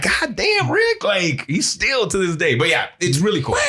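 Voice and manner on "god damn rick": disbelief. Retold or imitated. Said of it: "I'm like".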